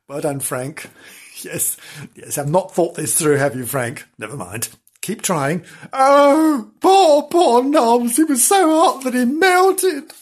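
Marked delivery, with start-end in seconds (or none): 5.9-10.1 wailing grief. Acted. Mourning melted friend.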